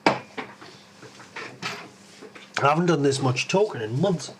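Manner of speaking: ordinary talk, nothing marked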